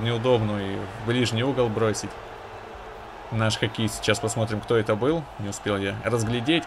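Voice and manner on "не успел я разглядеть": ordinary talk, nothing marked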